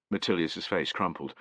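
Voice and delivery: ordinary delivery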